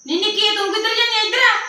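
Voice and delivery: a mother calling her younger daughter over. Acted. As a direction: summon the girl back before she leaves.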